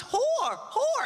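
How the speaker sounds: mock accent, pointing; mock Japanese accent. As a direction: call it out like a tiny royal. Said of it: "she goes".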